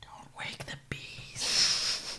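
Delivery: breathy whisper, slow. Real, not acted. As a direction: hush so the dog sleeps. Whispering about sleeping dog.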